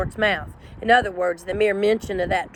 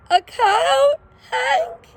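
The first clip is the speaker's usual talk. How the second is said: high, whiny little-dog protest; high-pitched worried whine; Drover's voice; 4 dB louder, much slower than usual. A narrator's reading. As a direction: cry out his name like a frightened pup. Drover realises danger.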